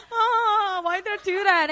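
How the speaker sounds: high, whiny mock sob; whimpering regret. Fallen rider regretting braking, mock-tearful whine. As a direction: wail in hammed-up self-reproach. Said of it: "I wasn't crying, but I was like".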